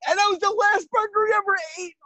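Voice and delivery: ordinary talk, nothing marked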